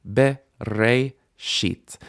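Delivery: BE, RE, SHIT spoken as separate beats; syllable by syllable. Shown as a demonstration. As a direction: break the word at each syllable. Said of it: "be is a syllable".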